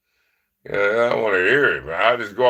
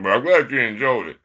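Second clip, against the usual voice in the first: ordinary delivery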